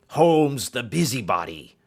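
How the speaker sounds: loud, bullying demand. A narrator's reading. Roylott bullying Holmes about his stepdaughter, loudly angry. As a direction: push him hard for answers.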